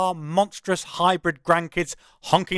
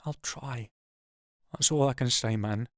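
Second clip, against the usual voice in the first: much softer, barely a breath; whispered; 3 semitones lower, 6 dB quieter than usual. Acted. Narrator answering the old man's plea, whispered. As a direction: hush it right down. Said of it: "I whisper".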